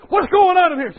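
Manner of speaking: high, loud guard's challenge; loud demand. Retold or imitated. Armed guards walking in on the patient, loud demand. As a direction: call out on bursting into the room.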